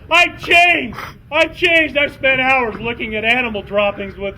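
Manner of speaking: high loud insistent cry; desperate pleading shout. Acted. Jason insisting to the skunks he's changed, loud. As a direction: beg them to believe you.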